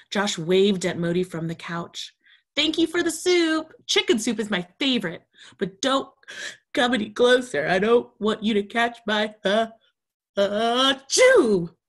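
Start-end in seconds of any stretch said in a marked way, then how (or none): 2.6-10.9 put-on higher, louder child voice; polite sick kid warning his friend off; stuffy sick boy. Josh thanking Moti for soup while keeping him away. 11.1-11.8 loud sudden sneeze mid-word; sneeze breaking through. Acted. Josh sneezing mid-sentence while warning Moti.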